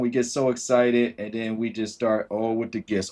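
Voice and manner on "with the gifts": normal talking voice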